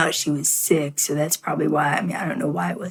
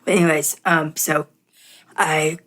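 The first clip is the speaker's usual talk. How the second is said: normal talking voice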